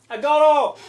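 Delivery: high, loud call of Arrow; calling horse. Retold or imitated. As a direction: bellow Arrow like the game hero. Imitating the game character calling.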